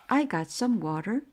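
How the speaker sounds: plain reading voice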